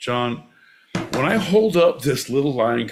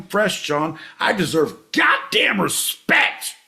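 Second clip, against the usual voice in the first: high-pitched outraged rant; indignant rant; 6 semitones higher than usual. Pretend. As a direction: rant like a wronged taxpayer. Indignant rant about taxes.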